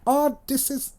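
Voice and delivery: high-pitched mock Irish lilt; brief Irish impression, pitched well up; Irish accent. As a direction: start gushing in a put-on Irish accent. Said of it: "that's Irish".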